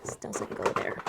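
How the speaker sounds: a quick unvoiced whisper to herself; whispered aside. Real, not acted. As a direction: whisper it under your breath. Muttered aside while sorting threads.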